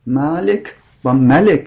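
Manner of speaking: «ملک و ملک» slowly, with clearly different vowels; slow contrast malik malak. Shown as a demonstration. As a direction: spell out two Arabic words slowly. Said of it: "تو لسان قریش".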